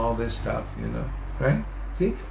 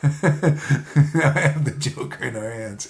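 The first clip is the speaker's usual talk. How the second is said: laughing; slower than usual. Real, not acted. Laughing at his own joke.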